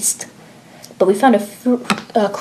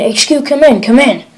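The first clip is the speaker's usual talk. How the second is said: a higher, pressing call for help; urgent radio call; 5 semitones higher, 4 dB louder than usual. Acted. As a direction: push the call out, desperate now.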